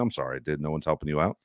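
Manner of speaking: low, soft, sympathetic words to a teammate; consoling. Retold or imitated. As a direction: comfort him like in a hug. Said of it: "I just give him a hug and go".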